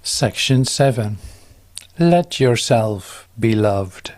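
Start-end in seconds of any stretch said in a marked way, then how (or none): none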